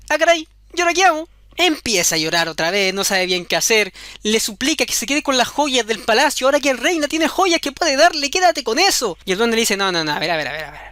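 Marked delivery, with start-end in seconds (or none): 0.1-1.3 put-on high, fast fretting girl; the girl despairing at more straw; panicked girl. Queen panics over her son. 9.8-10.8 low goblin refusal. Acted.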